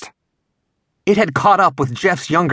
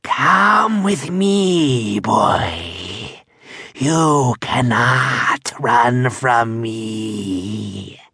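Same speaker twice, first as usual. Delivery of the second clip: very slow, low, slurred creature voice; low raspy whisper; 4 semitones lower, much slower than usual. A narrator's reading. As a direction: hiss it slowly like a monster. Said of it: "whispered in a low, raspy voice".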